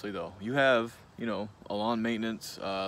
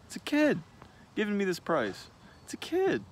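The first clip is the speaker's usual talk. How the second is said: shocked customer. Retold or imitated. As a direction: pitch jumping way up in disbelief. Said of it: "you're thinking".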